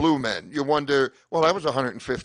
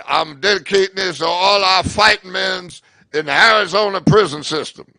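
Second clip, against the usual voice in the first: put-on louder old bluesman stage patter; old bluesman continued; 8 dB louder than usual. Old bluesman dedicating his song to the crowd, mock bluesman patter. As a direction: keep the old bluesman talking to the room. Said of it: "old man comes out".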